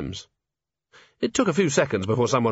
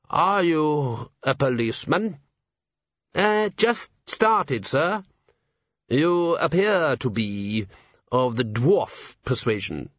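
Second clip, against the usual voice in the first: slow weary patrician; 4 semitones higher, slower than usual. Acted. Patrician and Cheery.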